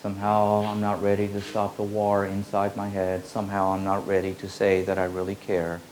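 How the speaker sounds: ordinary talk, nothing marked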